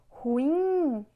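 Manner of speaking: ruim with a long, loud swell on IM; ru-IIIM, drawn out on the last syllable; calling out, stressed syllable. Shown as a demonstration. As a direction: call out ruim, stretching the final syllable. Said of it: "Quando você vai chamar".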